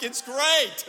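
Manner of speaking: high, loud put-on cheer; mock enthusiasm. Dad hiding his escape from kids, mock enthusiastic. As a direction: overplay delight for the kids.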